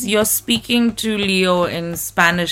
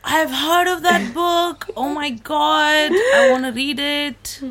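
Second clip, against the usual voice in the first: thrilled, high and slow; excited; 9 semitones higher, slower than usual. Real, not acted. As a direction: gush over the title. Reacting to the book title.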